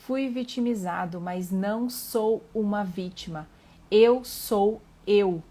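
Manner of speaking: emphatic declaration building to loud, slow words; deliberate, forceful self-assertion; firm affirmation. Acted. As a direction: claim your space, word by word. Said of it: "reivindique seu espaço e afirme".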